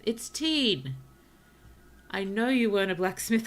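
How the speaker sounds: loud delighted shout; excited exclamation. Real, not acted. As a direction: call out the name with glee. Excited recognition of villager.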